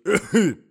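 high, loud acted cough; old man's cough. Old husband coughs before going to bed, loud cough. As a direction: break off into coughing.

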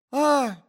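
high, loud gasp-cry; short high "ah" of alarm; startled shriek. A narrator's reading. Lady startled by the young man, startled shriek. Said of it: "вскрикнула дама".

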